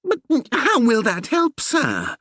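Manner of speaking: high, slow timid question; anxious small man pleading; worried curate. A narrator's reading. The curate asking the Reverend how it helps, worried. Percy voiced.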